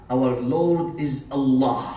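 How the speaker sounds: normal talking voice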